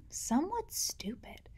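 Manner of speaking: whispered aside. A narrator's reading. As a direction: drop to a confiding whisper. Narrator confides about boys.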